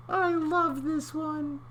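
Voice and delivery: very high little voice; comic high clam. Acted. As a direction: squeak it as the clam.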